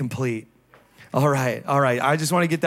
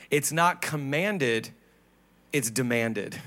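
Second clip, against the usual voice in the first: softer, slower, stressing "not" then "demanded"; stressed contrast; 4 semitones lower, 5 dB quieter than usual. Real, not acted. Pastor to church, low stressed contrast. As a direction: drive the point down quietly. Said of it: "preacher contrasts commanded and demanded".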